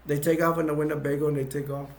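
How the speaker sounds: ordinary delivery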